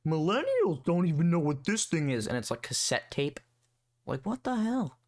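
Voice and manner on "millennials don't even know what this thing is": a light mock of a smug older man; mocking middle-aged dude. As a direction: parody him, a bit higher and smug. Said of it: "and he's like".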